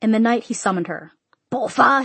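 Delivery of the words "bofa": raised, higher summoning call; father's summons, pitched up. A narrator's reading. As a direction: call your daughter from another room. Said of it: "he called".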